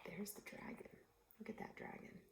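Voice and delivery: whispered aside to the listeners; hushed aside. Real, not acted. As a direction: whisper it as if pointing at the picture. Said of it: "reader whispers aside to listeners".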